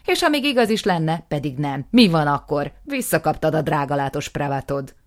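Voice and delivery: loud, sneering comeback; mocking retort. Aftab mocking his brother, loud and sneering. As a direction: sneer it at him.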